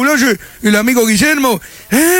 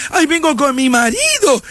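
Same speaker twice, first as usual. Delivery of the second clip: mimicking a woman; 2 semitones higher than usual. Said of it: "Viene una mujer y".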